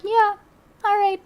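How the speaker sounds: high, light imitation of an easygoing yes; Indian driver's reply. Streamer imitating an Indian driver's head-nod reply, high mock voice. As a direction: toss off a high mock okay like a tuk-tuk driver. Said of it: "The Indian head nod has a lot more".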